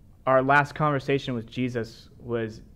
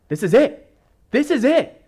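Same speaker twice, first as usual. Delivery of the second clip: rising, excited shout, higher and louder; freaking out; 12 semitones higher, 8 dB louder than usual. Retold or imitated. Retelling the disciples freaking out, a high excited shout. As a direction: yelp it out, the big moment is here. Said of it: "they're freaking out going".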